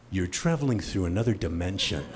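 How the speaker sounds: low, soft ominous TV narrator; Rod Serling impression. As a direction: intone it like the Twilight Zone host. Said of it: "Rod Serling impression".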